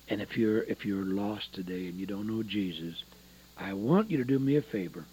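low, soft, earnest invitation; gentle altar call. Real, not acted. Preacher inviting the lost to pray, gentle altar call. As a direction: softly invite them to bow in prayer.

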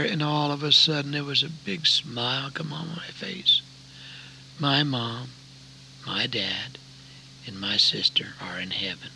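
low, soft graveside storytelling; solemn testimony. Real, not acted.

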